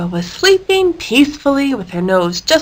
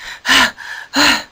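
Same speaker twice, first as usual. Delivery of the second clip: tired sigh; 4 semitones higher than usual. A narrator's reading. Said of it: "she sounded like this".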